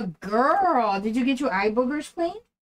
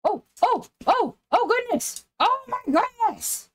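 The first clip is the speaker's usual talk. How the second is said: startled exclaim; 12 semitones higher than usual. Real, not acted. Dog grabs at her.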